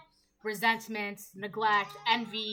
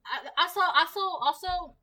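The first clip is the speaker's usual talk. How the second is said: also repeated higher and louder, stretched out; stuttering also; 6 semitones higher, 5 dB louder than usual. Real, not acted. Reader tripping over also mid-reading, pitched up and loud. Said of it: "I don't know why I'm saying it like that".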